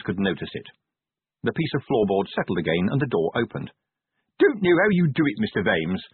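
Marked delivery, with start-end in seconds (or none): 4.4-6.0 a cheery, louder sergeant's greeting; cheerful. A narrator's reading. Sergeant greeting his commander at the door, cheerfully. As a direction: breeze in pleased with yourself. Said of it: "said Sergeant Colon cheerfully".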